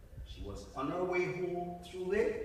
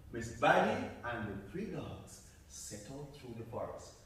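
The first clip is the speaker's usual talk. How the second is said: plain reading voice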